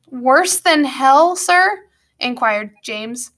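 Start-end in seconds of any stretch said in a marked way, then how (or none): 0.1-1.9 put-on slow, rising boy's question; alarmed boy. James to Grumpkin on the voyage, alarmed question. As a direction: climb higher to a worried "sir?".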